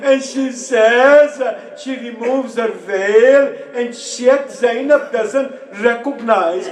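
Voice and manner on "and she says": ordinary talk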